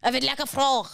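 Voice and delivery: put-on comic French accent with throaty r. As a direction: order it in mock French. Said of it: "pull off a good accent".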